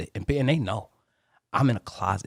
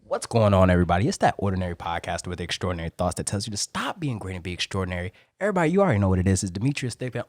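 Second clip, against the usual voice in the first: loud, punchy show opener; big, projected host greeting; podcast intro; 5 dB louder than usual. Shown as a demonstration. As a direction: introduce yourself to the audience at full energy. Performs own intro on request.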